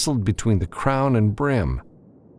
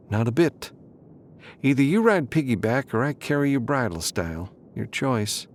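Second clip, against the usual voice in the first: higher, amused insistence; Sharon, teasing; 6 semitones higher than usual. A narrator's reading. Sharon insists on carrying Matthew, teasing and higher. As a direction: offer it with a grin in the voice.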